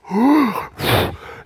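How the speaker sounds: big acted gasp; sharp, high intake of amazement; astonished gasp. Preacher hears unexpected thanks, astonished gasp. Retold reaction to surprise news.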